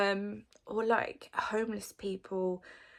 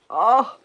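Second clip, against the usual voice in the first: overwhelmed laugh; 5 semitones higher, 16 dB louder than usual. Real, not acted. Overcome by emotional cat videos.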